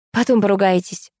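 ordinary delivery

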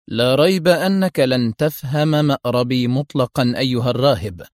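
ordinary delivery

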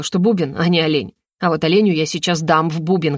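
plain reading voice

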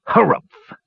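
high, gruff dismissive snort; snorted harumph. A narrator's reading. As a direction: snort it out like an irritated old dwarf. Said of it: "snorted Lodestone".

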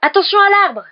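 high, very loud warning shout; cried out. A narrator's reading. Harry warning Ron just before the crash, shouting. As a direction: yell an urgent alarm. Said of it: "s'écria Harry".

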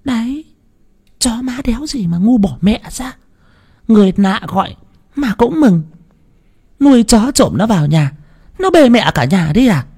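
put-on high old woman snapping him down; sharp old wife. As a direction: brush him off, high and scornful. Bà vợ chê con chó. (giọng bà già cao, gạt phăng)

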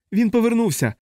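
high panicked scream; hysterical shriek. A narrator's reading. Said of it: "знову заверещав Данбар".

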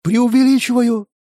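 high-pitched, disbelieving echo; incredulous. A narrator's reading. Man asking back, not believing his ears, incredulous. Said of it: "не веря собственным ушам, переспросил".

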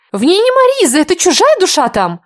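very high, loud accusing cry; sudden shriek. Acted. Lily accusing the heroine before the king, high loud cry. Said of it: "внезапно крикнула Лиля".

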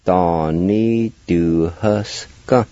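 Donnie du huske drawn out very slowly, each part separate; slow and careful. Shown as a demonstration. Teacher slowly modelling the word for students. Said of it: "You pronounce it like this".

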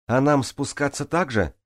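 high-pitched call down; shouted question. A narrator's reading. Джанель asks swimmers below how to descend, calling out. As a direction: call the question across a distance. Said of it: "крикнула в ответ Джанель".

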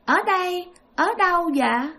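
a hint of a lost little kid asking; child's voice. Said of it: "cái giọng của một đứa con nít". (giọng con nít nhẹ)

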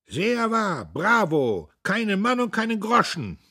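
high, loud calls of approval; cheering crowd. A narrator's reading. Audience applauds old Buck's speech, cheering loudly. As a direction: call out approval from the floor.

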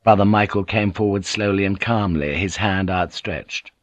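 ordinary delivery, nothing marked

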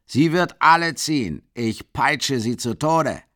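loud then slow menacing threat; furious threat. A narrator's reading. Mikolka threatening to whip the horse to death, furious. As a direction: spit the threat out. Enraged carter boasting.

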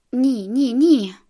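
loud repeated refusal, building; mounting, forceful protest; emphatic refusal. A narrator's reading. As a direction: refuse harder with each word. Bella refuses the wedding in story.

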